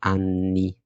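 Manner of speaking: "أني" with the N stretched, "annn-ni"; low, drawn-out "أني" showing the double consonant; lengthened double N. Shown as a demonstration. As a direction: press and hold the N in "أني". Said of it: "بنطول في النطق بتاعها شوية".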